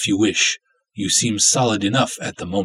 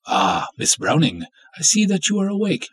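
ordinary delivery

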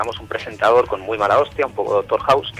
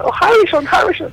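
high, loud screaming fan squeal; hysterical fangirl; 7 dB louder than usual. Pretend. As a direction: swoon over Harrison like a screaming fan. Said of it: "como una fan histérica".

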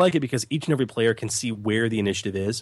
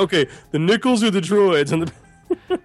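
high, loud, mock casual player; 8 semitones higher, 5 dB louder than usual. To his gaming group, a lightly voiced player. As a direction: toss it off like it's nothing.

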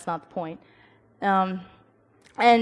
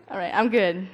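ordinary delivery

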